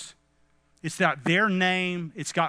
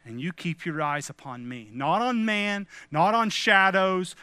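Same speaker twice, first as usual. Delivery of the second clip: ordinary talk, nothing marked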